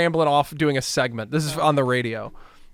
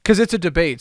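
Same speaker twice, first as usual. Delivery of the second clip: ordinary talk, nothing marked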